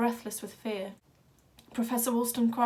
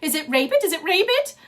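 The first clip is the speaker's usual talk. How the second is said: much higher, loud frightened yell; panicked cry; 8 semitones higher, 12 dB louder than usual. A narrator's reading. A terrified audience member yelling at the blood-covered boy. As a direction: shriek it in panic.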